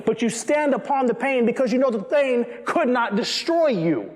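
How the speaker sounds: ordinary delivery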